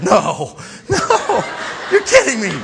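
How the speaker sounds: loud, high mock astonishment; hammed-up incredulous cries; shocked disbelief. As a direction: react to scandalous gossip. Gossiping workers react in disbelief.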